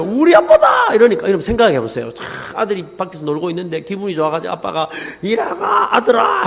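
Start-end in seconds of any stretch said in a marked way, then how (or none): 0.0-0.9 high, drawn-out boast; child's proud cry, pitch way up; proud little boy. Retold or imitated. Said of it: "애들 앞에서요". 5.2-6.5 high, drawn-out call to a son; stretched name, as if across a yard. Retold or imitated. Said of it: "하고 불렀는데".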